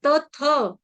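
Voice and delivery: ordinary talk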